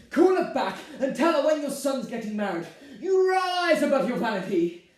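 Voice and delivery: loud, high, imperious order; angry command. Acted. Bride ordering groom to ring mother, angry. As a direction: dictate his words to him forcefully.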